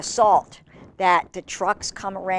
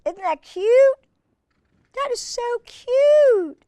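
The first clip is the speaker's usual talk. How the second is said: gushing; 5 dB louder, slower than usual. Real, not acted. Gushing over grandkids picture.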